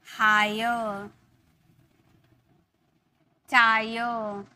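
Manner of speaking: लायर, हायर, टायर, वायर, ड्रायर spoken slowly, each ending in ayer; slow demo words. Shown as a demonstration. Teacher models the ayer words slowly. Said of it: "हम इसकी pronounce किए हैं".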